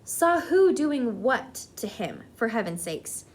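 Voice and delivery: ordinary delivery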